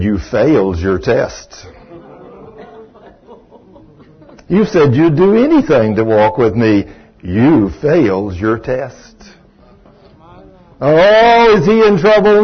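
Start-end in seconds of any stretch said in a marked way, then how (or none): 0.0-9.2 low, slow divine voice; voice of God. Retold or imitated. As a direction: speak slowly and low, as the Lord rebuking. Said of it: "I hear this wonderful voice".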